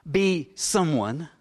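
normal talking voice

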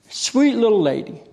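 usual speaking voice

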